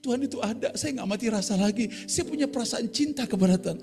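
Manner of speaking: mock tearful young woman; tearful thanks. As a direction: mimic her thanking through tears. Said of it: "dia nangis jadinya".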